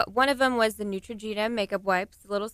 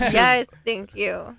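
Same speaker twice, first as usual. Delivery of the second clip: drawn-out, louder mock gratitude; mock thanks; 3 semitones higher, 8 dB louder, much slower than usual. Guest voices a reply to the woke-up snorer, mock thanks. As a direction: stretch out a loud, playful thank-you.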